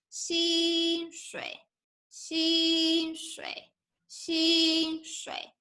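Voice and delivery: 薪水 said with both syllables high and level, first tone; Mandarin 薪水. Shown as a demonstration. 老师示范薪水，两个第一声. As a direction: hold 薪水 high and even on each syllable. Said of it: "可以吗".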